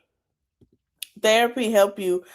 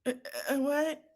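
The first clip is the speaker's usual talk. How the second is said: ordinary talk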